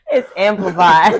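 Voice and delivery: slow, loud words through laughter; laughing hard on the words. Real, not acted. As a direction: say it while cracking up. Cracking up at shower joke.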